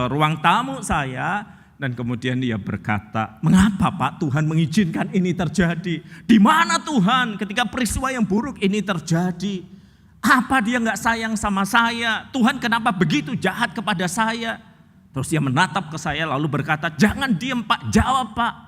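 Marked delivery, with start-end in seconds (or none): 3.4-14.6 raised, louder desperate pleading; mock-tearful woman asking why God allowed it. Distraught woman pleading with pastor. 16.9-18.5 high, insistent demand; impatient woman urging the pastor. Retold or imitated.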